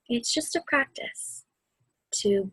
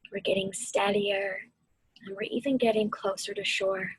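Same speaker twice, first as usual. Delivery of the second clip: guided meditation. Acted. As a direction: ease them through the storm and back to calm.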